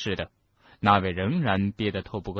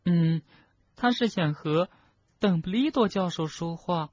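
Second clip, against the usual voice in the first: put-on high, tearful, halting girl's voice; choked up; 9 semitones higher than usual. Schoolgirl faking a tearful confession to professor, choked. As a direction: confess through feigned sobs. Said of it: "哽咽了一下".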